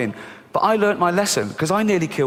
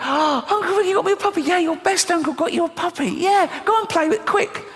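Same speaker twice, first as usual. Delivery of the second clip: very high, squealing put-on delight; mock excitement; 13 semitones higher than usual. Niece and uncle over the new puppy, mock overexcited. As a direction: imitate thrilled squeals, pitched way up.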